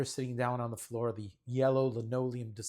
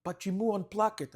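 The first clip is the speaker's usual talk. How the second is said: put-on higher, urgent kid voice; worried campers; 7 semitones higher, 4 dB louder than usual. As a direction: voice the anxious children, pitched up. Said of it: "the kids crowd around me and they say to me".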